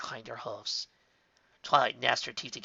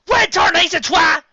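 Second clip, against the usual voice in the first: angry shout; 15 dB louder than usual. A narrator's reading. Applejack shouting.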